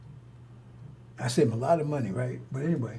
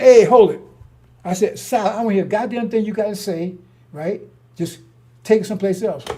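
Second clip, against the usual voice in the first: loud, high hold-it shout; retold loud rebuke; 5 semitones higher, 11 dB louder than usual. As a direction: cut him off firmly. Telling off talker in sauna.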